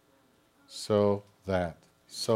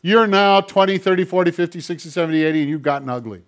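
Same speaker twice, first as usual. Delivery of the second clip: a hammed-up sneering voice, pitched higher and louder; mock harsh God voice; 7 semitones higher, 6 dB louder than usual. Pastor mocks a cruel imagined God with loud sneering. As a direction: sneer the insults like a cruel judge. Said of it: "Is God look at you and say".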